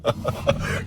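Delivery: low amused chuckle. Acted. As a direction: chuckle darkly at the news. Villain amused by revelation.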